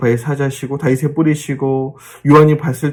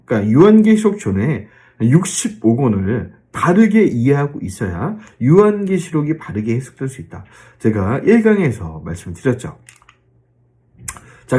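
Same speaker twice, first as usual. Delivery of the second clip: ordinary talk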